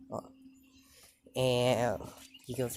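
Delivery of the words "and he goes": ordinary delivery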